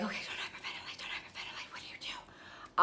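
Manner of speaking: whispered self-talk, barely voiced; panicked whisper. Talking herself down from panic at baggage claim, a frantic whisper. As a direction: whisper to yourself to stay calm while panicking. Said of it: "Now I'm like".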